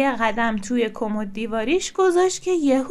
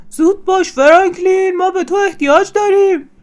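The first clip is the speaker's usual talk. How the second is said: loud, higher urgent call; shouting; 6 semitones higher, 7 dB louder than usual. A narrator's reading. Bear calls Franklin to join the knight game, shouting. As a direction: call your friend over at a shout. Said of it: "خرسی داد زد".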